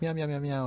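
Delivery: high playful meows; mock meowing. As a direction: meow like a cat. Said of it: "Jazz comes in and is like".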